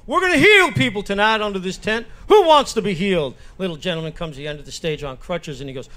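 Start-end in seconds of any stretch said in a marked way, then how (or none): 0.0-3.3 projected revival-tent call; faith-healer preacher. Acted. Preacher to crowd in revival tent, rousing. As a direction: work the crowd with punched-up words. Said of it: "he's preaching to the crowd".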